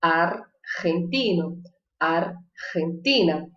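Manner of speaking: Argentino with a throaty jota, slow and low; Spanish jota demo. Shown as a demonstration. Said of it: "eu vou dizer ar, argentino".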